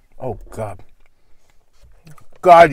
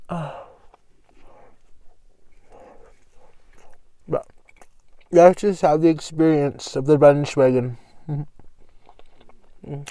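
chewing while talking; eating a sandwich; mouth full; slower than usual. Real, not acted. Seen 3: Bit sandwich just before; lips and jaw work food while talking.

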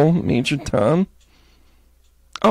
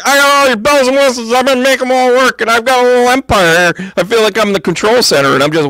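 high, louder, hammed-up self-impression; 自己得意时的样子; 9 semitones higher, 3 dB louder than usual. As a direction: play up your own giddy boasting. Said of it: "This is me going like".

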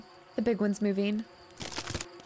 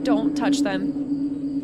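drawn-out, louder warning; slow, careful don't touch; 5 dB louder, much slower than usual. Real, not acted. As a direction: stretch every word out as a caution. Warning near spider eggs.

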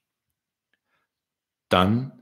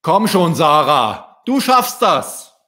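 high, loud words of encouragement; encouraging father; 7 semitones higher, 6 dB louder than usual. A narrator's reading. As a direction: cheer your child on, high and loud. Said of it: "ermutigte sie Papa".

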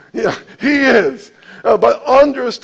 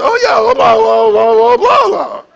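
high, loud sailor-mouth gabble, much higher; mock cursing like a sailor; 12 semitones higher, 6 dB louder than usual. Preacher acting out his old self cursing back at a man, high loud gabble. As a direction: rattle off curses in a swaggering higher voice. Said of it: "but why do you talk that way".

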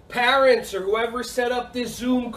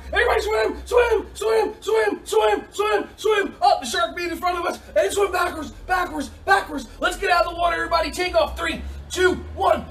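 high, urgent shouting; mock panic; 10 semitones higher than usual. Coach fleeing pretend shark with kids, high frantic commands. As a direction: keep yelling high as if chased.

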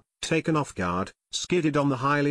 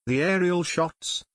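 higher-pitched, mildly excited exclamation; exclaiming; 6 semitones higher than usual. A narrator's reading. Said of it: "he exclaimed".

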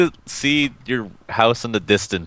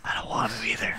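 unvoiced whisper; breathy whispered reply; 12 dB quieter than usual. Real, not acted. Whispered aside.

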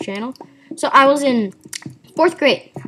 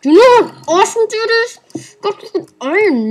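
excited shout; 11 semitones higher, 10 dB louder than usual. Real, not acted. Finds iron, excited yelling.

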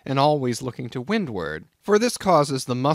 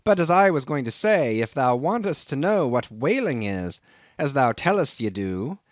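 crusty Nantucket captain sizing up a greenhorn; gruff old sea captain; 3 semitones higher than usual. Acted. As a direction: bristle at the stranger like a crusty old skipper. Captain introduces himself.

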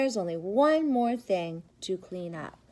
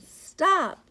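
high, loud call to halt; shouted stop; 10 semitones higher, 8 dB louder than usual. Acted. Character calls out to Mister Gilly, loud stop. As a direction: yell it to stop the truck.

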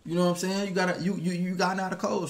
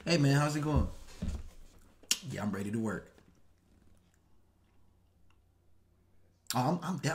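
softer, lower proper job-interview voice; corporate code-switch voice; 10 semitones lower, 8 dB quieter, faster than usual. Retold or imitated. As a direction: play the polite job applicant, quiet and proper. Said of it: "But if you".

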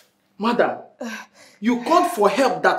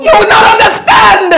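crying shout; 13 semitones higher, 12 dB louder than usual. Acted. Wife screams at the police through her tears. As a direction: shout it through tears, much higher and louder.

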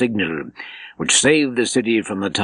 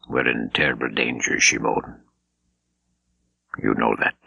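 much lower, hushed warning to Shimon; voice dropped a full register; 9 semitones lower than usual. A narrator's reading. Bishop lowers his voice to warn Shimon of terrible danger. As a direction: drop the voice way down, confiding danger. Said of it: "His voice dropped a full register".

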